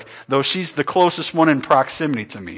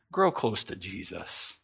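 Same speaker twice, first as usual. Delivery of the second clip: soft appeal; 9 semitones lower, 11 dB quieter than usual. Acted.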